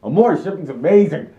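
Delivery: loud, excited fangirl shout; excited scream. Shown as a demonstration. Shouting to fans who clicked just for this. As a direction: belt it out like a thrilled fan. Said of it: "to hear me scream".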